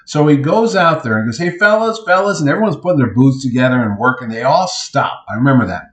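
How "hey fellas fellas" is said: higher-pitched call to the guys. Retold or imitated. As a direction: imitate hailing a busy crew. Calls out to booth workers.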